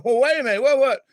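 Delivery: loud, high mock panic as a stopped driver; alarmed protest. Driver calling after the departing policeman, mock alarm.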